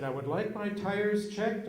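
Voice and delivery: mock prissy customer; prim customer. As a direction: say it like a particular lady. Fussy customer orders tire check.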